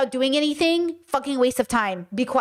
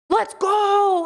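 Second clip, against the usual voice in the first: pumped-up, higher call to go; hyped shout; 8 semitones higher, 3 dB louder than usual. Retold or imitated. Streamer shouting a pep cry at complainers, pumped. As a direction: hype yourself up like a coach. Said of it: "I'm feeling very".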